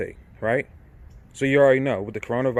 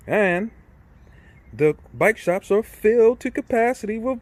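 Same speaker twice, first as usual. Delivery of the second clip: heated rant; 7 semitones higher, 4 dB louder than usual. Real, not acted. Ranting about crowded bike shops.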